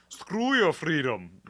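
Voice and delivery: slow, mock deep German accent on "Screw your freedom". Shown as a demonstration. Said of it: "said in a deep German voice".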